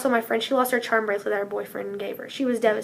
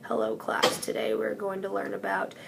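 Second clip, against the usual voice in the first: lightly voiced droning teacher, low and soft; a hint of a bored lecturer's monotone; 3 semitones lower, 8 dB quieter than usual. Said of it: "they don't speak in a monotone voice like".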